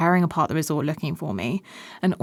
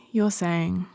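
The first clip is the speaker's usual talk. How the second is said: normal talking voice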